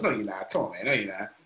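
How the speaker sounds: a hint of a sceptical inner voice; doubting inner voice. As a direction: lightly voice the little nagging doubt. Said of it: "that little voice goes".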